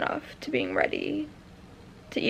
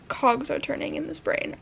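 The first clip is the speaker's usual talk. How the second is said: normal talking voice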